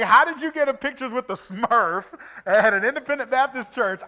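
high, loud, incredulous question; comic disbelief. Retold or imitated. Preacher jokes about Smurfs at Baptist church, loud incredulity. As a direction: ask in loud mock astonishment.

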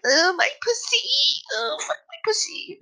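high-pitched parody of a porn star moaning; mock nasal porn moan. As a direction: ham up a whiny porn actress, way up high. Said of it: "if you have some nasally voice".

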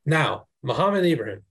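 usual speaking voice

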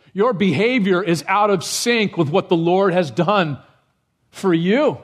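ordinary talk, nothing marked

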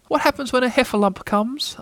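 high, timid voice; trembling Piglet. A narrator's reading. Piglet hearing it's a Heffalump trap, fearful. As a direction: ask fearfully, high and small. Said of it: "trembling when he heard the news".

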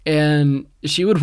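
usual speaking voice

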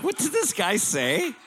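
high, slow confused voice; puzzled onlookers. Retold or imitated. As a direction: play the stunned crowd wondering. Room reacts in story.

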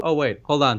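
ordinary delivery

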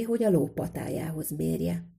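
ordinary delivery

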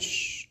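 soft, voiceless "shh"; hushing shh. Retold or imitated. Rabbi stopping the boy's apology, soft hush. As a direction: gently shush the speaker.

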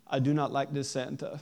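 put-on low, deadpan little boy, every word distinct; little boy, clear as day. Dad imitates little Roman refusing Santa, low and clear as day. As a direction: imitate a small child's plain, low-pitched refusal. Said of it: "It just as clear as day".

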